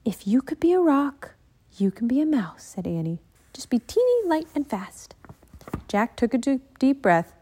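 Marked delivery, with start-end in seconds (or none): 0.0-2.6 put-on a lightly higher, eager little-girl voice; a keen girl coaxing her brother; lively Annie. 3.5-5.1 put-on a lightly higher, eager little-girl voice; a keen girl coaxing her brother; lively Annie. Annie coaching Jack.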